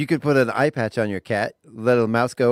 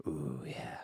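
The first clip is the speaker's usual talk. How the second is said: dreamy whisper; 10 semitones lower, 17 dB quieter than usual. Real, not acted. Savoring creamsicle idea.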